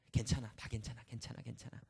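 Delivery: 점점 잦아들어 속삭임이 되는 다독임; 자기 마음을 토닥이듯 낮게 흘려 말하기; 스스로 달래듯. Retold or imitated. Soothing himself, thanking God. (스트레스를 내려놓으며 스스로를 다독이는 낮은 속삭임)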